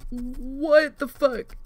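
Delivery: drawn out slow in shock; stunned disbelief. Retold or imitated. Gamer reacting to a teammate's blunder, slow stunned. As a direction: stretch it out in disbelief. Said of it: "and I was just like".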